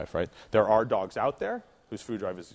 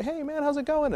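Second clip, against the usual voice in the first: bright, much higher friendly hello; mock friendly passer-by; casual stranger greeting. Shown as a demonstration. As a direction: greet the owner breezily, ignoring the dog. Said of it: "I would walk straight up and I'd say".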